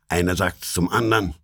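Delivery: ordinary delivery, nothing marked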